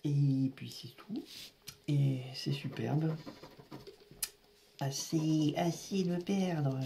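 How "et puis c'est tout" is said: slow resigned whisper; slow whispered resignation. Real, not acted. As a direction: breathe it out, defeated. Resigned whisper at bad luck.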